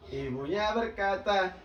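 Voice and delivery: ordinary talk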